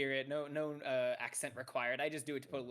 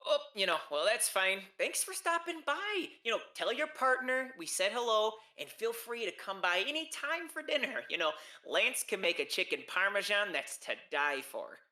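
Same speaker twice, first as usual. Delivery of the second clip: high, effusive Minnesotan singsong; see them off with a big Minnesotan lilt; Minnesotan accent; 8 semitones higher, 7 dB louder than usual. Acted. Host invites the departing visitor back for dinner, Minnesotan accent. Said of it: "a Minnesotan accent".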